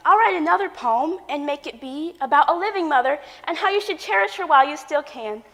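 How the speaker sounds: normal talking voice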